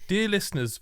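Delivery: only a hint of Chris's Northern accent. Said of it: "do it in his accent".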